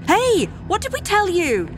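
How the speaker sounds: high-pitched parental telling-off; furious scolding. A narrator's reading. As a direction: scold runaway children, voice leaping up. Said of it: "join me in furious chorus".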